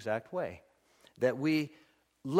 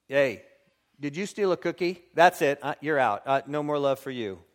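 mock strict parent; 6 dB louder than usual. Parent lecturing child, mock.

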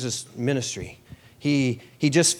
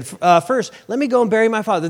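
eager follower; 8 semitones higher, 5 dB louder, faster than usual. Retold or imitated. Follower's excuse voiced.

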